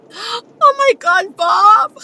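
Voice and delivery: high, drawn-out mock wail; fake crying. Shown as a demonstration. Woman crying at Bob to get her way, fake crying. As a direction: wail it slowly and high, hammed-up tears.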